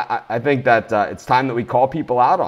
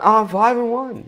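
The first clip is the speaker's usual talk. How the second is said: "Oh, five and one" much higher and slow, smug; mocking brag; 11 semitones higher than usual. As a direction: parody the other guys boasting. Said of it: "as opposed to the other guys".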